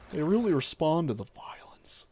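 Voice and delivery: unvoiced mock whisper; deadpan whispered aside. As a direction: whisper the punchline confidentially. Ends the mock talk in whisper.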